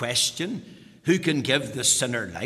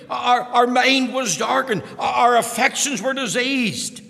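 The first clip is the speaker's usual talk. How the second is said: raised, higher preaching voice, pressing each point; fervent preaching; 7 semitones higher, 4 dB louder than usual. Acted. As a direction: preach it out to the congregation. Preaching at full force.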